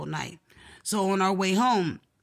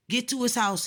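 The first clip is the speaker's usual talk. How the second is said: ordinary talk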